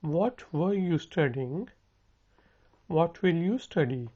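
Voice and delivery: very slow, each word spelled out for learners; slow it right down so students can copy; slow model sentence. Shown as a demonstration. Teacher slowly modelling a question for students to repeat. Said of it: "मेरे साथ repeat करें".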